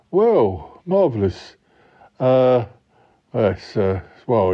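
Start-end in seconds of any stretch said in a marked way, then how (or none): none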